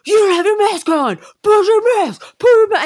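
high, loud mock shout at someone; imitating a yelling man. Mimicking a man yelling at her about her mask, mock shout. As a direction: bark the order like an angry stranger. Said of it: "this guy was screaming at me".

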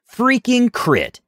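high, slow stunned groan; disbelief. Real, not acted. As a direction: drag it out in disbelief at the roll.

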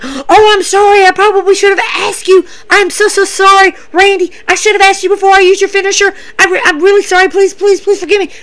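high, pleading apology; Matt Riddle. Retold or imitated. Matt apologizes to Randy for using his finisher, high imitated pleading. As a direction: imitate him begging in a high, hurried voice. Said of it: "Matt Riddle's like".

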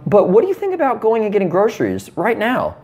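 lightly voiced wife. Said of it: "if my wife is like".

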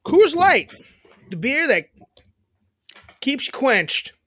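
booming commercial read; ad announcer voice. Pretend. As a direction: sell the beer like a TV ad. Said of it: "I wrote that".

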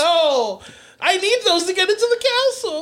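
mock anguished cry. Mock game player's cry.

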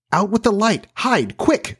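put-on high, alarmed boy giving orders; urgent command. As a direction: cry out orders in a high, hurried voice. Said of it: "cried John, taking command".

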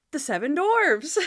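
high, hammed-up quip; awkward TV presenter being clever; [name]. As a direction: overplay the punchline brightly. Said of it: "Bad [name] acting is so funny".

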